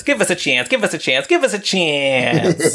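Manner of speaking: high, squeaking rat pleading; squeaky rat voice. Retold or imitated. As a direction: beg like a twitchy little rat. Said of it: "a rat voiced by Jerry Nelson who says".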